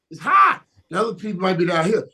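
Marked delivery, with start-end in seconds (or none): 0.1-0.6 "high" pitched much higher, rising. Shown as a demonstration. Host pushing his point up higher, rising. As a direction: reach up on "high".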